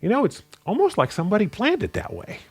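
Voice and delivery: high-pitched mock wonder, knowingly sarcastic; sarcastic joke. Preacher retelling his own quip about the parallels, sarcastic. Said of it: "I would kind of sarcastically joke and go".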